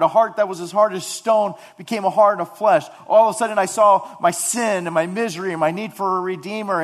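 loud, high, impassioned sermon voice; fervent preaching. Acted. Pastor preaching to his church, loud and fervent. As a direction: preach it out to the congregation.

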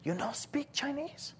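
high-pitched mock Chinese accent, slow question; Chinese-accented lady, high and soft. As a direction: imitate a Chinese lady quizzing a foreigner. Imitates Chinese lady questioning him.

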